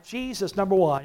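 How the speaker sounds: ordinary talk, nothing marked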